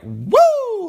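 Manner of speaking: a sudden high whoop; whooping woo. Real, not acted. She shouts woo about adding crazy religion, high and loud. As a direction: let out a loud gleeful woo.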